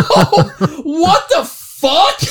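high, loud, drawn-out disbelief; reliving the shock, laughing it out loud; mock astonishment. Retold shock at contact picture.

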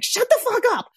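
put-on high-pitched child's cry of protest, pitched far up; inner child screaming. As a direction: let the hurt little kid burst out high and raw. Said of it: "beautiful scream from my inner child who was like".

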